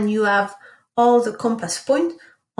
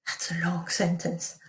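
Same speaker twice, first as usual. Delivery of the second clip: breathy unvoiced aside; whisper; 4 semitones lower, 8 dB quieter than usual. Real, not acted. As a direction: drop to a whisper for a quick aside. Teacher aside during dictation.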